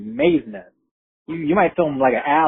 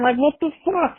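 indignant disbelief; 12 semitones higher than usual. Retold or imitated. Complains nobody warned him.

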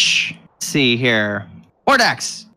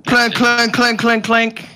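higher, evenly chanted clinks; clinking armour; 6 semitones higher than usual. Acted. Game master resuming the armour's approach, clinking sound effect. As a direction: imitate metal clanking with each step.

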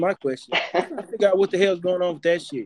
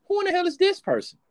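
high, squeaky mock disbelief; mock bewildered. Host mimicking his reaction to the co-host, high incredulous voice. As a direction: act baffled by a stranger in a high voice. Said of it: "And I'm like".

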